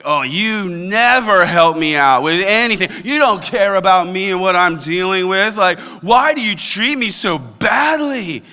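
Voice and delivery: nagging spouse. Retold or imitated. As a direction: whine it like a put-upon spouse.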